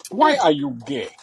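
loud mock African accent; generic African accent. Copying an African interviewer, mock African accent. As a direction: put on a broad, emphatic African accent. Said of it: "generic African".

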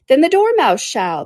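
high, slow, drawn-out call; bright-pitched eager cry, stretched out. Acted. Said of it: "they both cried".

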